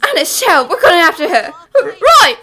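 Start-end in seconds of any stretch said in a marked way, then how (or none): 1.5-2.4 loud, stammered burst of agreement; flustered yell. Acted. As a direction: blurt it out loudly, tripping on the start. Flustered agreement to give chase.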